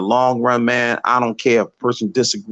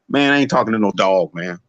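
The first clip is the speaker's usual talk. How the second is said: usual speaking voice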